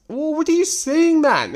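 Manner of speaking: high-pitched exasperated outburst. Real, not acted. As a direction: demand an explanation, voice jumping up. Exasperated rebuke of the texter.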